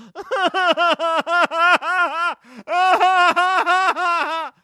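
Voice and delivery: loud, high, pulsing fake bawl; hammed-up wail in two long bursts; exaggerated mock crying. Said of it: "I don't cry like that".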